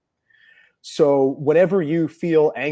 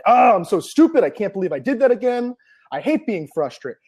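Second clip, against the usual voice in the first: self-scolding; 7 semitones higher, 3 dB louder than usual. Retold or imitated. Self-scolding quote after mistake.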